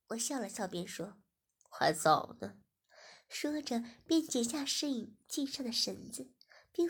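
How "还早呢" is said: a low, louder teasing voice; low master voice. Acted. Master telling Shiya it's not over, low tease.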